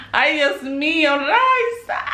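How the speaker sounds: high, drawn-out exclamation of amazement; overwhelmed delight. Real, not acted. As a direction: let the name soar up in delight. Overwhelmed on opening a gift.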